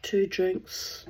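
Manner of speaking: ordinary delivery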